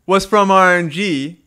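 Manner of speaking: slow, high and loud teasing build-up; dramatic reveal. Acted. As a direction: stretch it out like a big announcement. Dramatic reveal of a surprise player.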